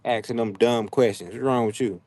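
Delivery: ordinary talk, nothing marked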